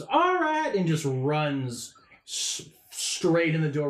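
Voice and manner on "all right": eager shout. Acted. Blumpkin bolts at the door, gung-ho eager shout. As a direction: blurt it out charging the door. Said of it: "Blumpkin goes".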